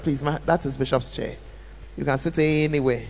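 soft, low, polite correction; polite request. Shown as a demonstration. Bishop's wife gently redirecting a guest to another seat. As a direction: kindly point the guest elsewhere. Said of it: "I won't say it in a way that".

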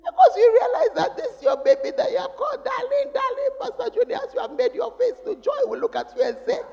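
high, loud, laughing through the words. Real, not acted. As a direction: laugh as you picture it. Laughing about daughter's wedding.

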